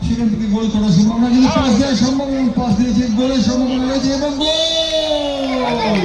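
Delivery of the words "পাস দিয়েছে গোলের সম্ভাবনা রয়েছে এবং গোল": high, drawn-out goal shout; goal call. Real, not acted. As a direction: stretch the goal cry slowly. Commentator calls a goal. (গোলের উল্লসিত চিৎকার)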